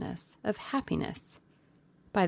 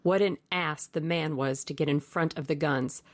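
ordinary delivery

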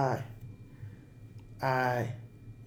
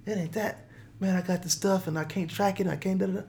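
panicked protest; 5 semitones higher than usual. Retold or imitated. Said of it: "I said".

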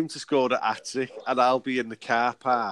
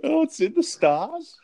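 much higher, mock-dreamy fan; put-on high voice; 3 dB louder than usual. To his mate about the title, a high put-on fan voice. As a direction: croon it like a starry-eyed fan.

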